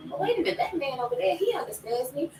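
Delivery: high-pitched mock reasoning; lifted, wishful voice; eager self-persuasion. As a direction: act out talking yourself into it. Acts out self-deluding thought.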